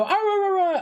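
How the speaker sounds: imitating a friend's wolf howl; mock wolf howl. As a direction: howl it out like a playful wolf pup. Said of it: "They go".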